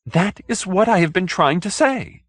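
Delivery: high, relieved yes; finally understood, grateful; gratefully. Acted. Hoag thanking Mrs Randall, high and relieved.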